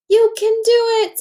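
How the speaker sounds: put-on root for your friend like a small boy; encouraging kid. [name] encouraging Cody before his solo, high eager kid voice.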